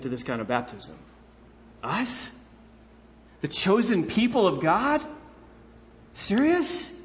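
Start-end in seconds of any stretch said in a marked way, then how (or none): 1.8-6.9 incredulous. Retold or imitated.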